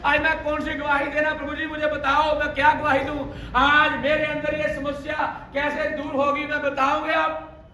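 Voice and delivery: high, loud, rousing sermon cry; fiery preaching. Acted. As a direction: whip the congregation up, shouting to the back.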